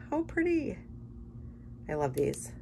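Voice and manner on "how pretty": delighted squeal. Real, not acted. Gushing over gold pin.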